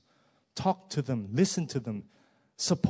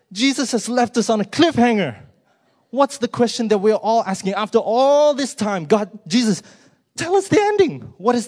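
much higher and louder, building to a cry; climactic excitement; 10 semitones higher, 10 dB louder than usual. Real, not acted. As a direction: plead for the ending at full force.

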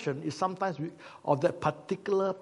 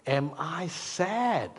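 very slow, inward, pondering question; like a man searching his own heart; slow self-questioning; much slower than usual. Retold or imitated. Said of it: "trying to ask himself".

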